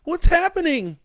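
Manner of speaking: high, loud panicked wife voice. A narrator's reading. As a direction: demand it in rising terror. Wife panics at missile launch.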